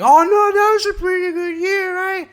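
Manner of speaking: sarcastic squeaky cheer about the year; mock chipper voice. Mocking people who think the year went well, squeaky chipper voice. Mocking a hopeful person's reaction.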